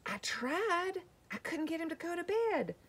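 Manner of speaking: high-pitched, whiny excuse; helpless daddy. Acted. Daddy explains to Mom he failed, high helpless whine. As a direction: plead it in a squeaky, defeated dad voice. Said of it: "Daddy's going".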